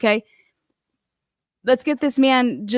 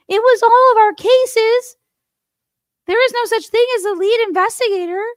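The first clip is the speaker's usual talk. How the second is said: much higher, louder, whining mimicry of the fired cop; mocking whiny trooper; 14 semitones higher, 5 dB louder than usual. Host parodies fired trooper's self-pity, high whiny mimicry. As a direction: squeal his complaint back like a spoiled kid.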